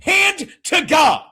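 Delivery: loud, high, slow insistence; shouting the punchline; emphatic shout. Real, not acted. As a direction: hammer it home loudly. Insisting on absurd detail, shouting.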